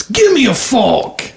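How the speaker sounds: put-on New Yorker accent. Joke teller voices the New Yorker stepping forward, hammed-up New Yorker accent. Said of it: "that's my, my best New Yorker accent".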